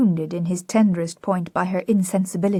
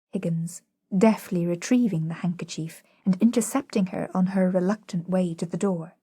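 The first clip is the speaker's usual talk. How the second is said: plain reading voice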